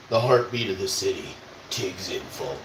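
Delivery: low, dramatic announcer voice; movie trailer voice. Pretend. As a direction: intone it like a trailer. Mock slogan in deep voice.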